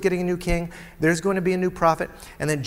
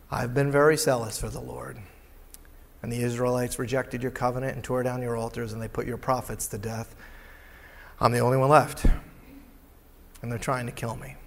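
lower, softer, humbled repeat; humble regret; 6 semitones lower, 4 dB quieter than usual. Acted. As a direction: say it with humility and regret, much softer. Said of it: "tons of humility, regret".

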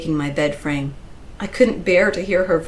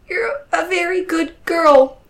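high, tearful reassurance; crying, emotional praise; choked up; 12 semitones higher, 5 dB louder than usual. A narrator's reading. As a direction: choke out comfort through tears. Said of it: "I choked".

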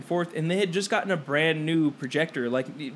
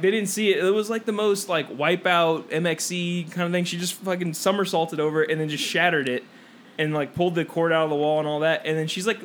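ordinary talk

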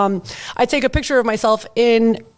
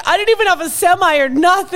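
indignant husband; 6 semitones higher than usual. Retold or imitated. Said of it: "He's like".

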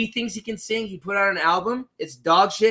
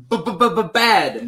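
stuttered bad.

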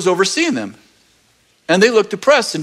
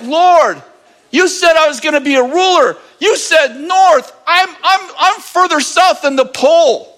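whiny complaint to God; 9 semitones higher than usual. Pretend. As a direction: complain to God like you've been cheated. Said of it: "And you're like".

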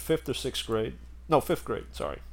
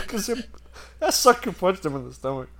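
normal talking voice

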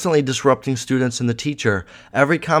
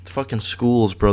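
ordinary talk

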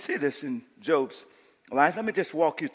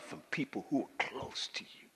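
whisper; 3 semitones lower, 13 dB quieter than usual. Real, not acted. Preacher whispers to the congregation.

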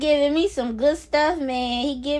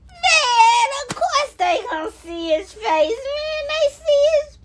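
much higher pitched, squealing with delight; excited; 9 semitones higher than usual. Real, not acted. Vlogger realizing everyone will see God's face, high-pitched excitement. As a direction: climb up high in giddy realization.

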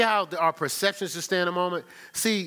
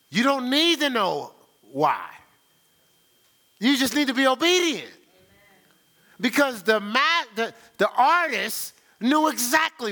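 preaching emphasis; 8 semitones higher, 5 dB louder than usual. Real, not acted. Preaching point building.

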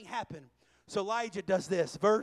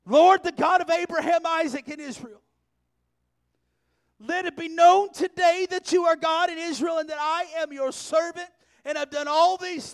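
fervent prayer; 6 semitones higher, 14 dB louder than usual. Acted. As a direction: pour everything out to God. Preacher voices Elijah's prayer.

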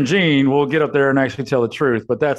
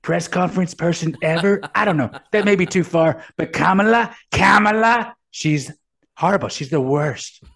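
higher-pitched, self-praising braggart; pitched-up parody of a bragging politician; mock boastful politician; 6 semitones higher than usual.

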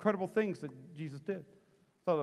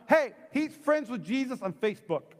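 higher-pitched mock accusation; calling him out; 6 semitones higher, 3 dB louder than usual. Serving girl exposes Peter to the crowd, higher comic call-out. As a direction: point him out to the crowd, playfully. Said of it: "So she calls him out".

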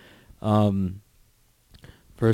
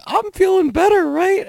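mocking a lower belt in a much higher, louder squeaky voice; whiny lower belt; 5 dB louder than usual. Mocking the lower belt after getting smoked, high squeaky parody. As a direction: squeak it up like a needy beginner. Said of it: "did I used to sound like that?".

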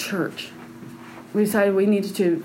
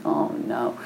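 low dismay; 10 semitones lower, 6 dB quieter than usual. Retold or imitated. Retold dread at getting saved.

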